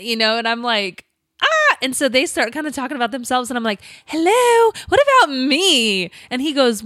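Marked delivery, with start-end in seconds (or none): 1.4-1.8 sudden high shocked yelp; a startled cry on hearing the news; surprised shriek. Retold or imitated. Wife shrieks at the test news, high startled yelp. Reacting to husband's positive result. 4.0-6.1 high mock-indignant call for attention. Wife butts into the nurse's call, mock indignant and high. Left out while they chat.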